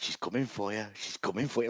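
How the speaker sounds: quietly winding her up; teasing. Retold or imitated. Man winding up his date at the church, teasing quietly. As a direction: tease under your breath. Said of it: "Like, winding her up".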